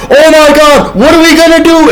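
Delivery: high, loud mock panic; panicked. Survivors chased by an infected, high mock panic. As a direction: squeal it like you're being chased. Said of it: "we're like".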